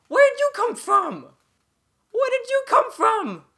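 very high, loud, drawn-out shriek; outraged screaming. Real, not acted. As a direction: shriek in baffled protest at an ambush. Surprised by enemy, shouts twice.

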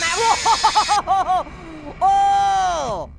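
loud wavering yell of shock; long startled "whoa" ending in a shouted "oh"; startled scream. Real, not acted. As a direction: scream out at a jumpscare. Jumpscare reaction in horror game.

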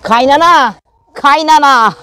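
high, loud teasing call. Real, not acted. Calling the grumpy hen Kaynana, loud and high. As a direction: shout the name like a playful summons.

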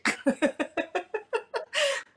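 a long, high, rising laugh; real delighted laughter. Real, not acted. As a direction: laugh at the memory. Laughing after the punchline.

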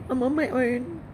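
normal talking voice

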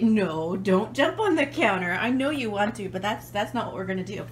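loud, firm no to the dog; firm dog command. Real, not acted. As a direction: scold the dog off the counter. Telling dog off the counter.

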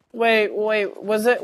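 ordinary talk

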